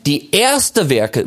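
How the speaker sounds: "erste" stretched out, falling onto "Werke"; stress on erste Werke. Shown as a demonstration. Preacher rejecting the other reading, stressing "erste Werke". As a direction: insist slowly on "erste Werke". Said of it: "das ist die Betonung".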